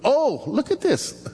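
a surprised high oh, slowing down; caught out by own notes. Real, not acted. Pastor catching his misread note, surprised and amused. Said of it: "See, I can't even read".

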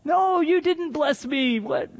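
high, drawn-out acted dismay; mock-distressed, pleading denial; confused, sad protest. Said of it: "he's very confused, and it's really sad".

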